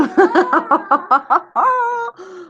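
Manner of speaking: long high laugh that tails into a wail; big wailing laugh. Real, not acted. Teacher recalling her own blunder to students, big wailing laugh. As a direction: burst out in drawn-out, high-pitched laughter.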